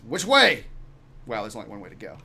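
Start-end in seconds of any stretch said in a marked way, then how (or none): 0.0-0.7 raised, louder demand; urgent captain. Acted. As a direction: ask sharply for directions.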